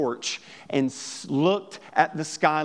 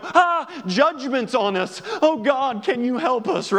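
mock desperate plea; 6 semitones higher, 3 dB louder than usual. People crying out to God.

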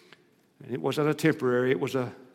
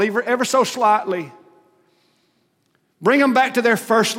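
building prayer, louder and higher; fervent prayer; 5 semitones higher, 5 dB louder than usual. Acted. The congregation praying for backsliders, pleading and building. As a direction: plead with God, rising in force. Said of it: "and we say".

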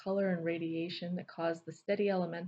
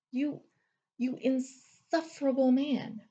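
higher, slow, seething words; furious; 7 semitones higher, 6 dB louder, slower than usual. A narrator's reading. Naomi fuming at Thunder. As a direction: spit out each word in rage.

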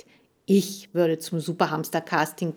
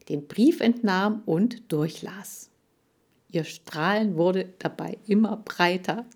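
usual speaking voice

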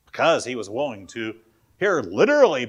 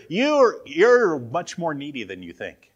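high, loud push on you're; emphatic; 3 semitones higher, 5 dB louder than usual. Retold or imitated. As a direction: lean hard into it. Preacher stresses Jesus' point.

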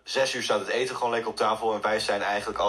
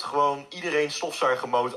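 usual speaking voice

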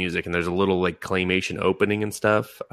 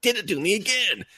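high, pitched-up mock outrage; retold mock outrage at the koala twist; mock exasperation; 13 semitones higher than usual. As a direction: squeal it like the show fooled you. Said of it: "I was like".

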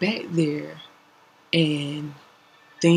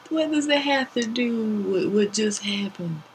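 baffled; 6 semitones higher, slower than usual. Retold or imitated. Baffled retold question.

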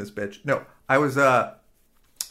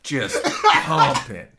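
Billy Crystal impression; 3 dB louder, much slower than usual. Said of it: "I was just be doing Billy Crystal there".

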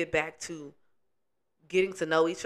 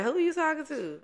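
higher-pitched, imitated mother's challenge; mock motherly rebuke; 11 semitones higher than usual. Daughter imitates mama answering being called girl, mock motherly rebuke. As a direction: imitate an affronted mother pulling rank.

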